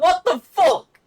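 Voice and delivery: high, loud retold outburst; incredulous outburst. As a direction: exclaim it in loud disbelief. Retelling his shocked outburst.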